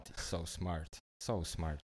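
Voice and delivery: soft, sarcastic murmur. Acted. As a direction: say it softly, mocking. Sarcastic after dumb remark.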